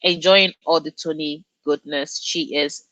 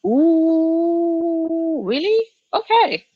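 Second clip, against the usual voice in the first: high rising ooh, really; intrigued surprise; 9 semitones higher, much slower than usual. Real, not acted. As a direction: sound pleasantly intrigued. Intrigued by recommendation.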